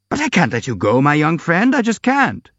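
put-on a mild, rising plea to the young man; pleading cry. A narrator's reading. General begging the prince to stay, rising to a plea. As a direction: let it climb into a plea at the end. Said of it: "cried the general".

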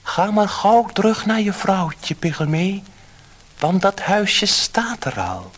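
high, loud kindly fish voice; high fish voice, slowing at the end; magic fish. A narrator's reading. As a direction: grant the wish like a gentle magic fish. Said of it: "het tovervisje antwoordde".

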